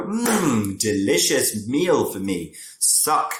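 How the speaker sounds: high, relishing vampire voice, slow on the meal; greedy vampire. Acted. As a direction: savour the victim like a hammed-up vampire. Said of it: "vampires will come along and go".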